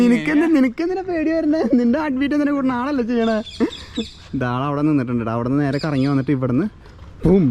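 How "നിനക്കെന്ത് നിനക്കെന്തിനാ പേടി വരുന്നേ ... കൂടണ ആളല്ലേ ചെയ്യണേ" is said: teasing. Real, not acted. Teasing nervous friend.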